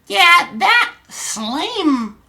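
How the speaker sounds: very high, slow put-on girl voice; girl's chat voice. Streamer voicing Sarah's chat message to Donnie, high girlish voice. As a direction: drag it out in a high falsetto. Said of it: "forgive my voices".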